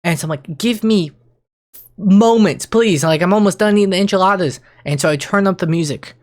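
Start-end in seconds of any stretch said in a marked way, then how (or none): 0.6-4.6 high, loud desperate begging; exasperated pleading. Retold or imitated. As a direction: beg for a moment's peace. Begging own brain to wait.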